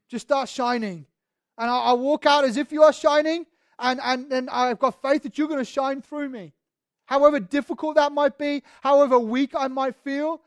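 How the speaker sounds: fervent preaching. Acted. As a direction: preach it out, full conviction. Preaching at full force.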